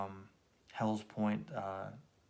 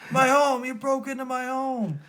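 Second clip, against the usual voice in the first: high raging shout; furious roar; 13 semitones higher, 8 dB louder than usual. Acted. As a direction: roar it at the intruder. Croc ambushes Batman, screaming.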